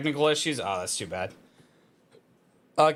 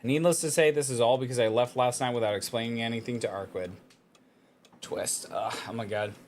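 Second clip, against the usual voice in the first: story narration. Acted. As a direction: read it like a novel narrator. Reads visual novel text aloud.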